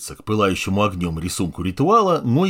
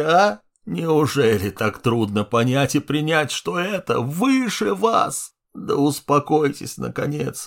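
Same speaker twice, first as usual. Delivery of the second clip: put-on contemptuous old man; 7 semitones higher than usual. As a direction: sneer down at lesser beings. Old man berates heroes.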